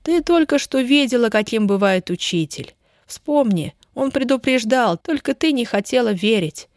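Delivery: usual speaking voice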